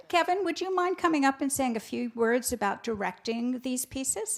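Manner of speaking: normal talking voice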